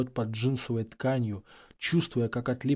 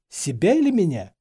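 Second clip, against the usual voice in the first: confused; 5 semitones higher, 6 dB louder than usual. A narrator's reading. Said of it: "Парень выглядел сбитым с толку".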